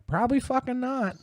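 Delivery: sarcastic answer. Retold or imitated. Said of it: "I'm like".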